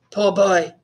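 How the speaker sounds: ordinary delivery, nothing marked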